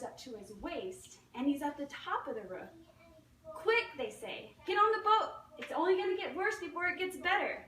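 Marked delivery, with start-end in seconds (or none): 3.4-3.9 urgent call. Acted. Rescuers call from the boat. 4.7-7.6 loud and high, pleading him aboard; urgent rescuer shout. Acted. Rescuers urge man onto boat.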